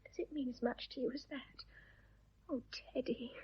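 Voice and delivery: very soft, tender whisper; moved whisper. Acted. Woman seeing her lover moved, tender whisper.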